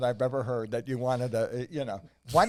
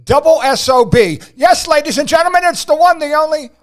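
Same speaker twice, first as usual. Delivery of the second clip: big announcer build-up; 9 semitones higher, 16 dB louder than usual. Acted. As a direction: roll out the name like a ring announcer. Announcer introduces John Kensel.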